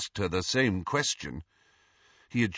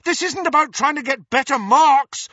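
high, loud, exasperated shout; 10 dB louder than usual. A narrator's reading. Harry argues with the dismissive ghost, shouting. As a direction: shout them down. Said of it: "Harry shouted at her".

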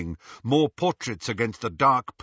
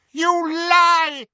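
high, loud furious cry; furious shriek; 9 dB louder than usual. Acted. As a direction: shriek it in rage. Voldemort's outburst.

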